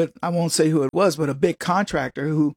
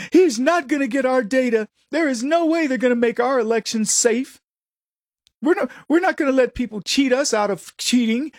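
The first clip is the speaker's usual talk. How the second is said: mocking the governor; 6 semitones higher than usual. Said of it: "That's what they're saying".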